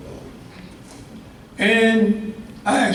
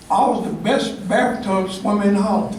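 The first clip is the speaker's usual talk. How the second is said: usual speaking voice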